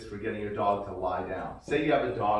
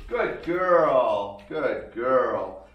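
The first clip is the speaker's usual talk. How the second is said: excited praise; 9 dB louder, much slower than usual. Shown as a demonstration. Praising dog for coming.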